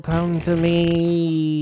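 slow, drawn-out ghostly call; spooky summoning. Pretend. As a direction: beckon the spirit slowly.

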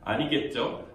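ordinary delivery

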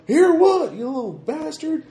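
high furious shriek; angry character shout. Acted. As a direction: snarl it in a squeaky rage.

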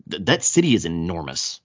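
ordinary talk

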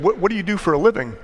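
high, quick Tennessee stranger; pry chattily in a Tennessee accent; lightly put-on Tennessee voice. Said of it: "in this really fine Tennessee accent".

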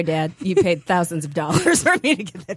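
ordinary talk, nothing marked

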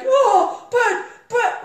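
loud, high mock panic; fear. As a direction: stammer out an alarmed protest.

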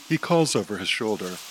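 ordinary delivery

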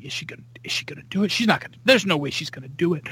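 fast, breathless whispered worry; anxious whisper. Retold or imitated. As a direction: hiss it fast under your breath, nervous. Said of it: "I was going... thinking".

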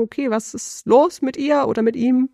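a hint of onlookers wondering what's wrong; puzzled listener. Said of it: "die Leute sich fragen".

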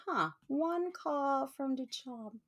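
a quiet curious huh, stretched out; intrigued musing. Retold or imitated. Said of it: "I was like".

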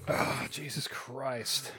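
slow, breathless whisper; whispered disgust. Real, not acted. Reacting to a gross video, he whispers slowly in dismay. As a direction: breathe it out in disbelief.

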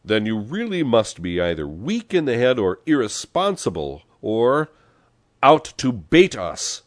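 loud, high, emphatic scolding; exasperated accusation. A narrator's reading. Pepone to the unafraid passenger, exasperated. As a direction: accuse him, hammering each possibility.

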